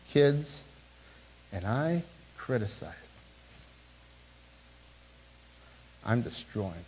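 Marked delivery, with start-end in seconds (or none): 1.5-6.8 hushed confession. Real, not acted. Confessing harm to his own kids.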